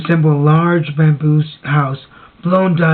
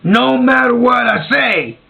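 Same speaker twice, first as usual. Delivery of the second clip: drawn-out, higher-pitched mock shout; imitated drunken plea; 6 semitones higher, slower than usual. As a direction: call out a hammed-up plea to the bartender. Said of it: "would plead with the bartender".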